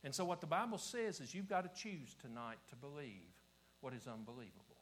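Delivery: usual speaking voice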